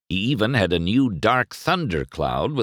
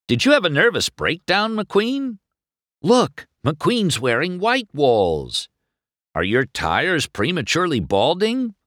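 high-pitched, pestering reporter; higher, insistent press-pack questioning; barking reporter; 9 semitones higher than usual. A narrator's reading. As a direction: fire questions like a pushy reporter. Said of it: "One reporter barked".

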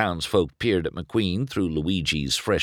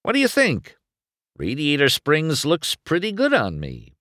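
lightly voiced race car; 8 semitones higher than usual. McQueen's voice.

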